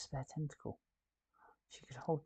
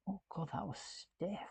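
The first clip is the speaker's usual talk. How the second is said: whispered; 7 semitones higher, 6 dB quieter than usual. Real, not acted. Struggling with a stiff piece.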